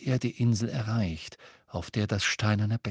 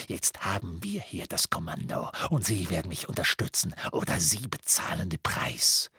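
quieter, low menace creeping to a whisper; menacing threat; 3 semitones lower, 4 dB quieter than usual. Acted. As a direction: threaten her from inches away. Amicus threatening McGonagall up close.

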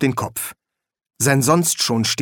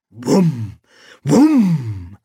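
two humming booms, second louder; imitated booms; 4 dB louder than usual. A narrator's reading. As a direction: boom twice, building. Sound of the pot firing.

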